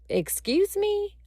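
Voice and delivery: very high, incredulous question; stunned disbelief. Retold or imitated. Traveler hears flights are cancelled, high-pitched disbelief. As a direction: squeak it as if you can't believe it. Stunned reply to manager's bad news.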